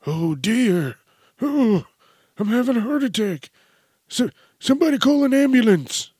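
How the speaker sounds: put-on high, loud old man voice, cranky and fired up; a cranky old geezer ready to brawl; feisty old man. Old man fights off mugger.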